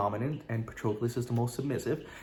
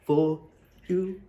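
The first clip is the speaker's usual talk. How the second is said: high, slow put-on British accent; a wobbly attempt at a British accent; half-hearted British accent with a yawn in it; failed British accent; 7 semitones higher, 5 dB louder, slower than usual. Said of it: "I was trying to do British".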